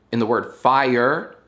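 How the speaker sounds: normal talking voice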